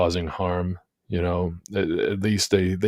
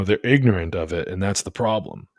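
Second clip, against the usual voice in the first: ordinary talk, nothing marked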